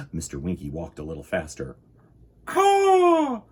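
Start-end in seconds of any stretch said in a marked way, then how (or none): 2.5-3.4 very high, loud crow caw; piercing, drawn-out caw; screaming crow. A narrator's reading. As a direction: screech it out like a crow.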